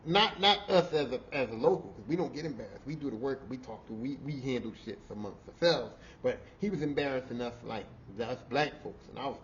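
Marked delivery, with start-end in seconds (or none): none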